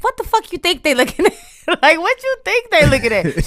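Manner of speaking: high, loud laughing self-mockery; mocking self-scold. Singer mocking herself for forgetting she's famous, laughing. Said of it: "I be forgetting, like".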